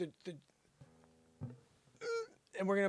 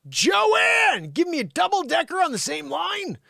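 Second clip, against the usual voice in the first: high, loud shout at the teleprompter operator; exasperated yell; 13 semitones higher, 4 dB louder than usual. Pretend. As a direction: yell her name, then complain in high pitch. Yells at teleprompter operator mid-read.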